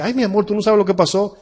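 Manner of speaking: a hint of his wife's eager gossip; voicing his wife. Pastor voices his wife. (imitando a su esposa, con voz más aguda)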